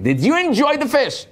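high, light mock waitress asking politely. Waitress asking the diner about his fish, high and light. Waitress voiced in retold story.

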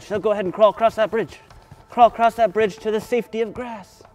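high, quick urging; urgent cheering-on. Pretend. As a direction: hurry the children along to safety. Urging children to crawl across.

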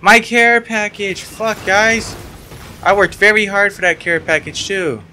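high, slow, pained wail; anguished whine. Real, not acted. Gamer complaining to teammates, high whine. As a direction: whine over the loss. Wailing over care package again.

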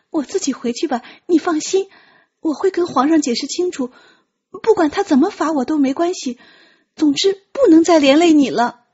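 high, loud and hurried worry; flustered young voice. Acted. Tian Qi refuses to burden Ji Zheng, flustered. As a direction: rush it out in alarm.

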